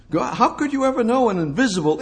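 normal talking voice

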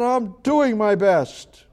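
put-on a child at the end of his patience, pleading; boy yelling that he is trying his hardest; frustrated boy. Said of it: "the little boy got so frustrated".

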